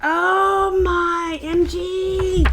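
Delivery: swooning excited exclamation; overwhelmed gasp. Real, not acted. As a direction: stretch it out, overcome with joy. Finds Princess Leia in magazine.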